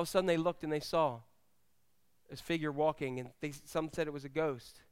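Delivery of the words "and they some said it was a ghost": ordinary delivery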